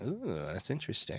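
soft, intrigued "ooh" swooping in pitch; quietly muse, as if just noticing something; retold intrigue. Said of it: "which I was like".

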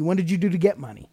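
ordinary talk

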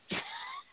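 quick high-pitched laugh; soft high laugh after the punchline; high giggle. Real, not acted. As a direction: burst into a short high laugh at your own story.

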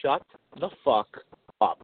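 low, deliberate, pausing between words; firm pretend warning. Coaching a friend to silence his wife, stern. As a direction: lay down the law, word by word. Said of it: "all you have to say is".

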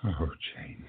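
a soft, grieving murmur; sorrowful sigh. Acted. Joe alone with his book, sighing her name softly in grief. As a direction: breathe her name through tears.

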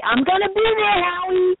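high, bright and thrilled; excited. Real, not acted. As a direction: announce it with delight. Surprise reveal she's coming.

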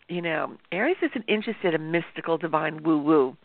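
usual speaking voice

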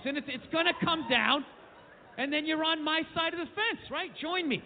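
normal talking voice

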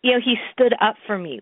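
usual speaking voice

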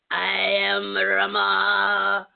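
slow, croaking frog-like monk voice; Tibetan frog voice. Retold or imitated. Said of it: "they do that frog voice".

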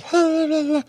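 short high falsetto babble; mock a fake girly whine without words; squeaky imitation of fussy nonsense; mimicked fussing. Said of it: "the whole time she'd been fighting me".

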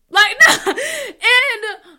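a sudden loud laugh that cracks toward crying; real laughter breaking into emotion; loud, high laugh on the edge of tears; laughing through tears. Real, not acted. Laughing while getting emotional.

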